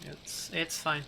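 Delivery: soft, hesitant brush-off; very soft figure. Acted. Stranger brushing off Sir Alan's concern, very softly. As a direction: keep it small and quiet. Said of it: "that's literally like, how she says it".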